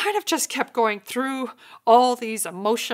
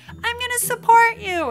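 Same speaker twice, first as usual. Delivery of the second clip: high, louder devoted voice; mock devoted partner; 13 semitones higher, 6 dB louder than usual. Other partner vowing support, high eager voice.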